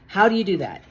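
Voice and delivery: normal talking voice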